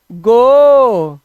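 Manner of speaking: "गो" very loud with a long-held O; final O sound. Shown as a demonstration. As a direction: belt out "गो", stretching the vowel. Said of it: "तो ओ को ओ पढ़ते हैं".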